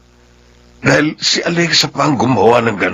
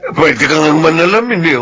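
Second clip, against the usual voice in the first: ordinary talk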